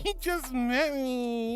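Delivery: slow, laughing through "You just met me"; laughing protest. Real, not acted. Streamer teasing a viewer who called her good girl, laughing protest. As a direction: giggle it out, playfully scandalized.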